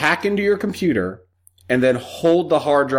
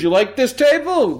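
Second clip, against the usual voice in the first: much higher mobster offer; goomba voice; 9 semitones higher than usual. Retold or imitated. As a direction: wise guy fishing with a hint. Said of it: "He goes".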